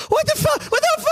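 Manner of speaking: very high panicked squeals of bystanders; high-pitched crowd panic; people freaking out. Acted. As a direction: shriek it like startled townsfolk. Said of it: "people start going".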